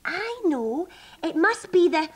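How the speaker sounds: high, eager outburst; sudden realisation. Acted. Rabbit suddenly solves the stripe puzzle, high and eager. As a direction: burst out with the answer.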